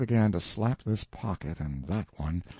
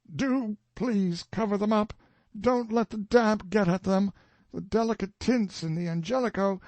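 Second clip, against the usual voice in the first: higher anguished plea called after him; anguished calling out; 12 semitones higher than usual. A narrator's reading. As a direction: call out in anguish after the departing man. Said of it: "shouted with an anguished accent".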